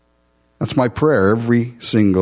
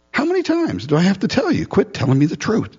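mock exasperated king; 9 semitones higher than usual. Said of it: "He's like".

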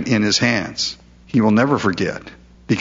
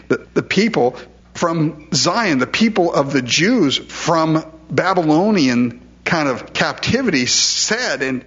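normal talking voice

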